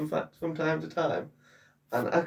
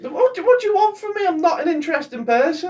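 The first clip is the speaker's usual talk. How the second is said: exasperated; 10 semitones higher, 6 dB louder, faster than usual. Real, not acted. Exasperated at being pressed.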